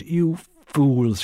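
scornful. Acted. Missus Cork scolding Bernice.

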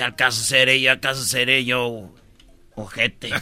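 low sarcastic echo of the question; mocking reply.

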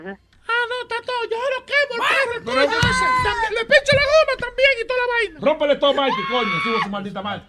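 high-pitched mock furious yelling; hammed-up angry threats. Prankster staging orders to Mike to smash the car, mock fury.

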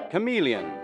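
ordinary talk

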